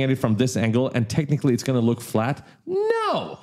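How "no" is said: very high squealed no, falling at the end; comic exaggerated no. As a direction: sing out a high, drawn-out refusal. Answers own rhetorical question comically.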